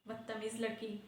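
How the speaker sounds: low and very soft, under the breath; grumbling insult. Acted. Teacher about the giggling girl, quiet grumble. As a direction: grumble it to yourself.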